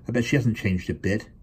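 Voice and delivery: ordinary delivery, nothing marked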